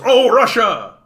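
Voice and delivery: high, loud mock exclamation; hammed-up shout of Russia as the villain; mocking everyone. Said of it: "everybody goes".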